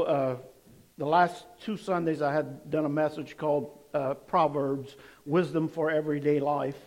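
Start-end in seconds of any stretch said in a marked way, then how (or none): none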